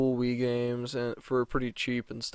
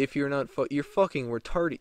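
ordinary talk

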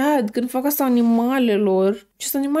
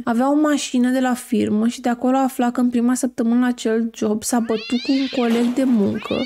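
ordinary talk, nothing marked

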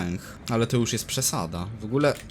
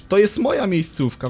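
indignant shout; 10 semitones higher, 8 dB louder than usual. Real, not acted. Yelling at the monster.

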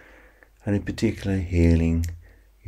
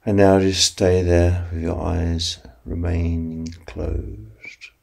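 slow counting hypnosis voice; guided sleep meditation. Acted. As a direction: ease them toward sleep, unhurried.

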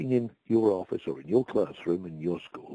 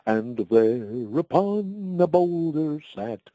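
slow, higher, sing-song verse recital; poem recitation; 4 semitones higher, 4 dB louder, slower than usual. Shown as a demonstration. Old man reciting his comic verse to the host, slow and lilting. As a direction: recite the rhyme slowly for the listener. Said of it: "I think if I could just remember one verse".

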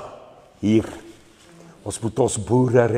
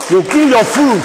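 high, loud cry of warning; rally shout; 10 semitones higher, 6 dB louder than usual. Real, not acted. Speaker warns rally about killing farmers, high shout.

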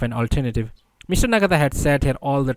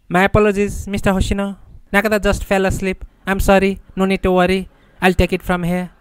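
high-pitched, apologetic character voice; Nakata's apologetic voice; 8 semitones higher, 3 dB louder than usual. A narrator's reading. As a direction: voice a polite old companion, pitched well up. Imagined dead Nakata sitting up speaking.

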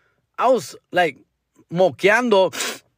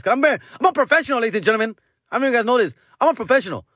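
higher-pitched, rushed start; mock boasting; 5 semitones higher, much faster than usual. Host assuring listeners after apologizing, mock-professional pride. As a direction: pitch it up in playful self-importance.

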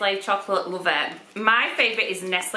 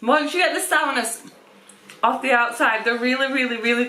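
chewing a sweet; mouth full; 4 semitones higher than usual. Real, not acted. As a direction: hand over mouth. Seen 0: Woman holds sweet packet, fingers at lips, chewing while talking.

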